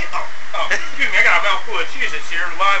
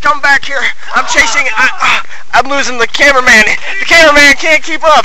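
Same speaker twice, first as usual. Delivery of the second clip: high, loud chase shouting; excited shouting; 8 semitones higher, 5 dB louder than usual. Pretend. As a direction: yell while chasing someone. Reporter chasing runaway subject.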